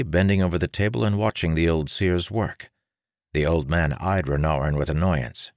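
ordinary delivery

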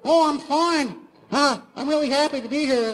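high falsetto cartoon-mouse chat; Mickey Mouse. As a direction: chirp it in a tiny high voice. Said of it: "He sounded like Mickey Mouse".

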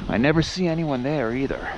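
ordinary delivery

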